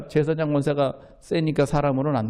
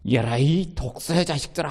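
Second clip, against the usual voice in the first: rebuke. Retold or imitated. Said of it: "책망을 합니다".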